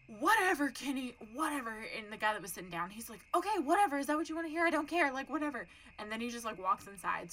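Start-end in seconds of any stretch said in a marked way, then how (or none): 0.1-1.7 loud, high dismissive outburst, imitated; fed-up retort. Imitating the guy arguing with his friend, fed-up yell. Acted retort in neighbors' quarrel. 3.3-5.7 loud, high fed-up retort, imitated; exasperated dismissal. Imitating the seated friend's comeback, loud exasperation. Acted retort in neighbors' quarrel.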